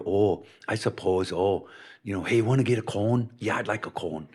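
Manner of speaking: Minnesotan long O in "oh" and "cone"; Minnesota accent. Retold or imitated. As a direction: lean on the round Minnesota O's. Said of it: "It's always the "oh".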